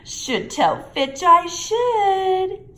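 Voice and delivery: high, slow mock-pious poltergeist; saintly voice. A narrator's reading. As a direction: put on a falsely holy tone. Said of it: "in a saintly voice".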